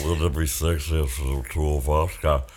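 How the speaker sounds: seductive low voice. Pretend. Seductive fantasy voice for Midge.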